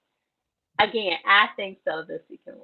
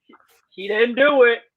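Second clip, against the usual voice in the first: mocking gossip voice; 4 semitones higher, 6 dB louder than usual. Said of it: "he gonna be on here talking about".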